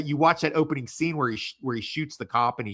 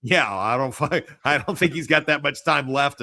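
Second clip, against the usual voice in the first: usual speaking voice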